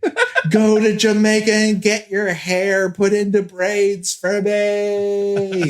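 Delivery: high, slow melodramatic dying farewell; overacted death scene. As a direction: ham up a hero's last words. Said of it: "shout about how awesome it is".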